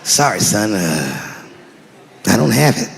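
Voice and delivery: low, sheepish father; deep dad admitting he is broke. Retold or imitated. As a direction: apologise low and awkwardly. Dad voiced.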